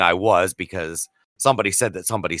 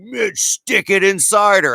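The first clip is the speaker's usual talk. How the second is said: high, slow repeat; exasperated insistence; 9 semitones higher, slower than usual. Retold or imitated. Bill repeating himself to clueless Mitch, exasperated. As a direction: stretch it out insistently, pitched up.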